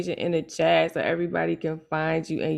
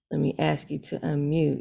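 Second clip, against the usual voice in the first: normal talking voice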